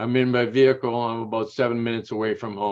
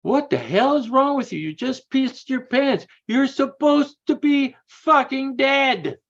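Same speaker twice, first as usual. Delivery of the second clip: much higher, louder, slowing down in disbelief; incredulous yell; 5 dB louder, slower than usual. Retold or imitated. Retold voice mocking the speaker for wetting himself, high and loud. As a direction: squawk it out like someone who can't believe what he's seeing. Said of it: "he goes".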